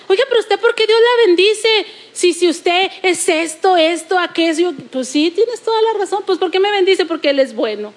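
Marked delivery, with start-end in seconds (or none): 0.1-4.7 higher, indignant complaint; mock indignation. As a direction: imitate someone protesting, a bit shrill in pitch. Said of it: "alguna gente se enoja y dice". (queja indignada imitada, más aguda)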